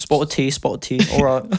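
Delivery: hammed-up British tea chat; mock Liverpool small talk; British accent. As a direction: put on a silly British lilt. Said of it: "you just sound like [name] on drugs".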